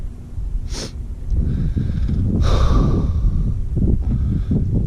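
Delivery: long unvoiced heavy sigh; long shaky sigh. Real, not acted. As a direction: let out a long grieving sigh. Upset vlogger between sobs.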